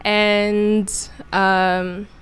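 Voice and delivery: normal talking voice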